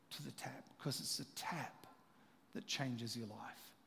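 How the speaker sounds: hushed, breathy near-whisper; whispered emphasis. Real, not acted. Preacher drawing the congregation close, a hushed whisper. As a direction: lean in and whisper it.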